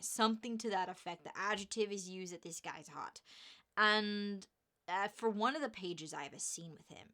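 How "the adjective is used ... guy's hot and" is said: ordinary delivery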